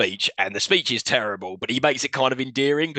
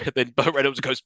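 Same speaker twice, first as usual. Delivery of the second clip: usual speaking voice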